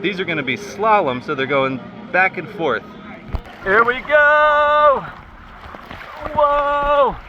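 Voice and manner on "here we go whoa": excited shouting. Real, not acted. Riding the mat slide.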